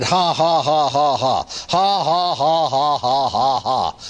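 long mechanical string of ha-has; forced laugh. Retold or imitated. Sick man keeps up forced laughter at the devil. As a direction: chant out a laugh you don't feel. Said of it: "flat made myself do it".

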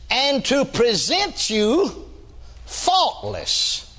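slow, drawn-out preacher reading; preaching. Acted. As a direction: stretch each word out. Scripture intoned slowly.

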